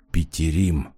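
low, softened musing grumble; pensive grumble. A narrator's reading. Man pondering an old acquaintance's name, pensive low grumble. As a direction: grumble the name thoughtfully to yourself. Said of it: "задумчиво проворчал он".

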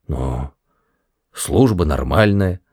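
low, hesitant mulling with a long pause; slow-witted. A narrator's reading. Guard struggling to weigh up his job, slow-witted and hesitant. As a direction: think laboriously before answering. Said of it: "с видимым напряжением пытаясь разобраться".

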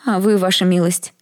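the maid crying out, fearing for her lord; alarmed exclamation. Acted. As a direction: cry out in alarm for her master. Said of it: "воскликнула служанка".

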